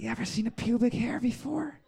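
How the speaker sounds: soft, creepy pilot voice; quiet creep on the intercom. Acted. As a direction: make a sleazy, hushed cockpit announcement. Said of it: "You sound like a creepy pilot".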